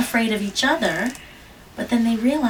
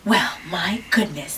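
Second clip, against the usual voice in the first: ordinary delivery, nothing marked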